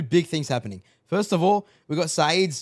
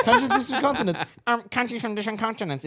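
mocking repeat; 6 semitones higher, faster than usual. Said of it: "if that's how you understand it".

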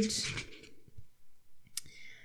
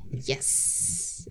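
whispered yes; 8 semitones lower, 10 dB quieter than usual. Real, not acted. Pulling a book from the pile.

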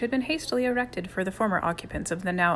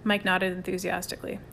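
ordinary delivery, nothing marked

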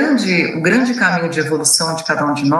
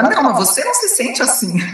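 lightly acted protest, much higher; mock contradiction; 7 semitones higher than usual. Colleague disputing someone else's feelings, mock contradiction. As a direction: contradict in a high, mock-objecting voice. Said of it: "virar pra mim e falar".

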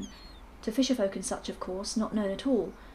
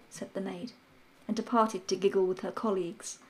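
usual speaking voice

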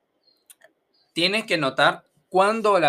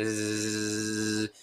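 long, low, steady zzz; insect buzz; 6 semitones lower, 5 dB quieter than usual. Shown as a demonstration. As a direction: imitate a little insect buzzing. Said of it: "Estos insectositos que hace". (zumbido largo de insecto)

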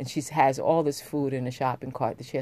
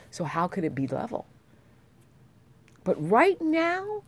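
impassioned; 8 semitones higher, 5 dB louder than usual. Real, not acted. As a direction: proclaim it with rising fervor. Impassioned speech on equality.

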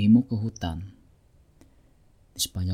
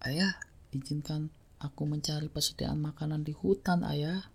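soft, higher-pitched pleading son; pleading young voice; 6 semitones higher, 9 dB quieter than usual. Acted. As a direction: ask father gently, like a young colt. Young horse pleads with father.

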